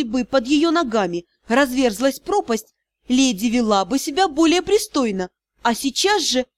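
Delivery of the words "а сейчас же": plain reading voice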